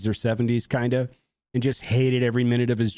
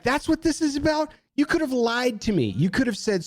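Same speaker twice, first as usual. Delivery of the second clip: high, indignant reproach; losing it; 11 semitones higher than usual. Retold or imitated. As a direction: accuse them in high-pitched disbelief. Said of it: "I lost my mind".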